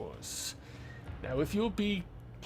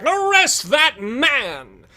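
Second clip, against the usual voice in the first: pompous hero shout; 9 semitones higher, 8 dB louder than usual. Acted. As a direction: announce it to the crowd like a vain hero. GM voices vain detective.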